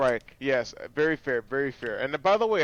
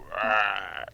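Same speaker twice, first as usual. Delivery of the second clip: imitated burp; 6 semitones lower than usual. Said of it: "you should let it rip".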